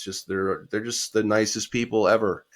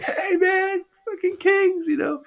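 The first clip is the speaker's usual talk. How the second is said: much higher, louder mock fan voice; thrilled fan greeting; 5 dB louder than usual. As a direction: squeal it like an excited fan. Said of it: "and Wolf's like".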